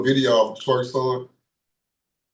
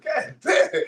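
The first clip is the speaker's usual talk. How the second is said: much higher, startled exclamation; shocked reaction; 13 semitones higher than usual. Retold or imitated. Retelling being shocked by the bar guest, high startled exclaim. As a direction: let it burst out in surprise. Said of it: "It shocked me".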